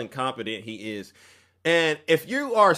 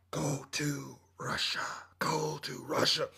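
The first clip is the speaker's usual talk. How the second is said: slow, hushed divine whisper; whispered voice of God; 9 dB quieter, slower than usual. Acted. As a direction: breathe it like a voice from above. God's voice answering the man.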